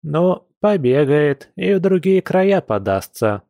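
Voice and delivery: put-on patronising old woman waving the matter away; condescending. A narrator's reading. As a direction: sum it up dismissively, drawing it out. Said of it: "и снисходительно подытожила".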